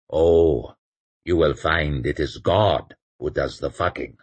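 solemn, grave intoning; solemn priest voice. Acted. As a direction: intone it like scripture. Joubert voiced, low.

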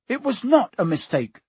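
put-on indignant boy answering back; angry. A narrator's reading. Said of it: "said Harry angrily".

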